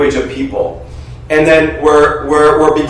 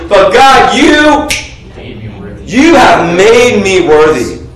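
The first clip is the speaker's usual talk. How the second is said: triumphant declaration; 4 semitones higher, slower than usual. Acted. Triumphant turn in sermon.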